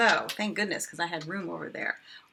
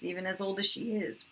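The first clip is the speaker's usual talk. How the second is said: ordinary talk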